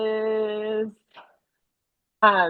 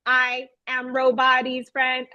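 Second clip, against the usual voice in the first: slow, evenly spaced robotic introduction; robot-like puppet voice. Retold or imitated. Teacher introducing a second puppet, slipping into robotic monotone. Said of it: "No, no, that's not how Bat, Bat talks".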